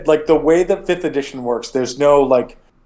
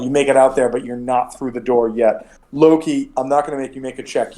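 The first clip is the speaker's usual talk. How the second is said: normal talking voice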